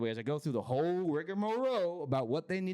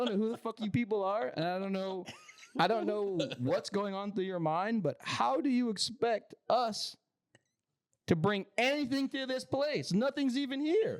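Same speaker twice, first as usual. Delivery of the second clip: exasperated rant; 8 semitones higher than usual. Retold or imitated. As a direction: build up the disbelief, getting higher. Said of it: "I was like".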